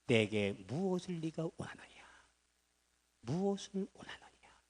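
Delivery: soft unvoiced whisper; whispered question. Elijah softly asking Elisha what he wants. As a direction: ask it hushed, tenderly.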